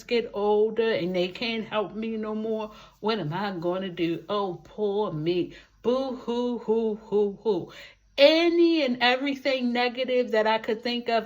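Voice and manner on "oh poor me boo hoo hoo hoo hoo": mock boo-hoo crying. Self-mocking pity after listing fears.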